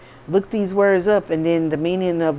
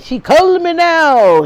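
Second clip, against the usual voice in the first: slow, high, loud mocking put-on Jamaican accent; mock a fake psychic's put-on Jamaican accent; mock Miss Cleo Jamaican; 12 semitones higher, 9 dB louder than usual. Mocking the fake psychic's phone-reading line, loud and slow in a Jamaican accent. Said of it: "using a Jamaican, um, voice and vibe".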